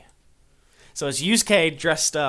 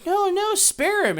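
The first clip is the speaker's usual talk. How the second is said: put-on much higher, louder pleading little boy; child begging; 5 dB louder than usual. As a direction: beg the villain in a small boy's voice. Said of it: "the little boy's like".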